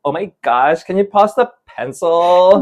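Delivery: put-on Californian celebrity accent, drawn out at the end; Kardashians. Pupils imitating reality stars in class, mock Californian drawl. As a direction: stretch it into a mock reality-star American accent. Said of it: "speaking like the Kardashians".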